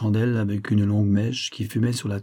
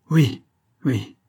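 an eager cry of yes; the young man bursts out, eager to leave; cried out; 4 semitones higher, 4 dB louder than usual. A narrator's reading. Young man cries out to his mother, eager. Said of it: "s'écria le jeune homme".

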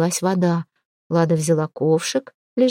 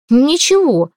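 loud, snapping with irritation; irritated; 6 semitones higher, 5 dB louder, slower than usual. A narrator's reading. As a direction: bite the word off, annoyed. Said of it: "с раздражением ответила богиня весны". (раздражённо)

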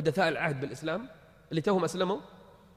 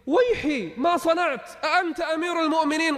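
much higher and louder, shocked; shocked gasp; 12 semitones higher, 5 dB louder than usual. Acted. Old woman realises the stranger is the caliph, shocked and high. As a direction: cry out in disbelief.

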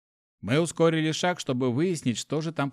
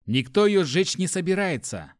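higher, irritated retort; growled retort; 6 semitones higher than usual. A narrator's reading. Hero grabs torch from companion, growled retort. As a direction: snap back in annoyance. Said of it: "прорычал".

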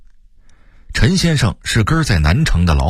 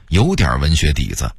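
ordinary delivery

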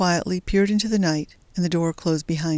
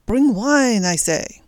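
"Bring wine" higher and louder, barked; angry command; 4 semitones higher, 4 dB louder, slower than usual. A narrator's reading. Officer repeats order impatiently, louder command. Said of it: "After a pause".

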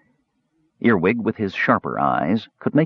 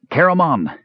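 raised, higher call to his brother ahead; 7 semitones higher than usual. A narrator's reading. Raistlin calling to his brother ahead, raised call. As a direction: call across the distance to Caramon. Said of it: "he called out".

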